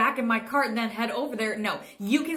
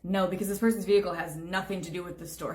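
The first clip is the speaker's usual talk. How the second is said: usual speaking voice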